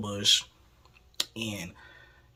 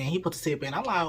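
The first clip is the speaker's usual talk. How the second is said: ordinary delivery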